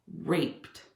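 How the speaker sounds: dropped to a whisper; hushed. Real, not acted. Heavy word in a rant, whispered.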